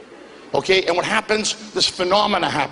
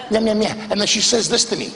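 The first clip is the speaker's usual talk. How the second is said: normal talking voice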